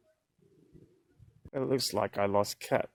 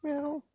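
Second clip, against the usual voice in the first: meow; 6 dB quieter than usual. Real, not acted. Meowing to the cat.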